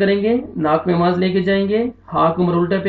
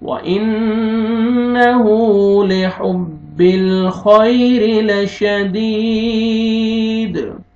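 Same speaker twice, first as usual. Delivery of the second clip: melodic Quran recitation; 3 semitones higher, 4 dB louder, much slower than usual. Acted. Teacher reciting with growing fervour. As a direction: chant the verses slowly, rising in force.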